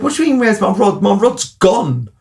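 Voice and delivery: exasperated. Retold or imitated. Retold exasperated reply.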